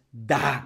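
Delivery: "da" with a hard, open ah; wrong full "ah". Shown as a demonstration. Teacher shows what it is not, full ah. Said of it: "no es da".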